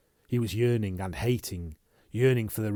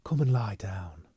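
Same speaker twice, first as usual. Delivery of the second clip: gentle whisper. Acted. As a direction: whisper to soothe him. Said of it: "Olivia Prestane said gently".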